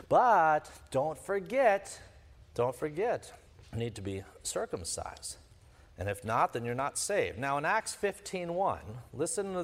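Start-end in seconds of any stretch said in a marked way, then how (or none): 0.1-2.0 slowly drawn-out warning, said very slowly. Retold or imitated. Judaizers patronising the Galatian convert. 3.7-7.4 put-on low, soft patronising voice, like talking to a child; hushed condescending warning. Judaizers patronising the Galatian convert.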